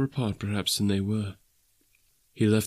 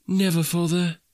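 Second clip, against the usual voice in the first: higher, earnest exclamation; children crying out; 8 semitones higher than usual. Acted. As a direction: promise father eagerly, pitched up. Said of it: "cried they all".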